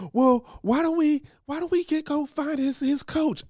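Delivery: high, prim busybody voice, well above his own; mocking a meddling lady. Stranger lady butting in at his son's race, high mock voice. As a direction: lift into a fussy stranger's sweet meddling tone.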